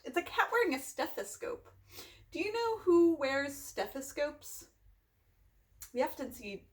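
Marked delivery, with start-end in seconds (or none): none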